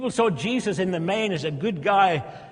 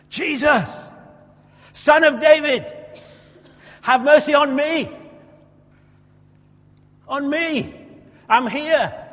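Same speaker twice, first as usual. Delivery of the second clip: loud, high desperate shout; 10 semitones higher, 8 dB louder, slower than usual. Acted. Blind man shouting to Jesus over the crowd, loud and desperate. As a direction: cry out over the crowd for mercy. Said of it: "he starts shouting".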